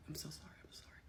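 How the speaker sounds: barely audible murmured sympathy; whispered condolences. Retold or imitated. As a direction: imitate hushed condolences in a whisper. Colleagues filing by offering condolences.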